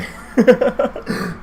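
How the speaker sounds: a breathy high giggle; airy high laugh. Real, not acted. Laughing at the driver's tame story, airy high giggle. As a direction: laugh it off lightly.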